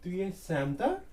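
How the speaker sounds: "chanteur" lifted high on its last syllable; rising question tone. Shown as a demonstration. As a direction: let "chanteur" swing up into a question. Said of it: "we are raising the tone".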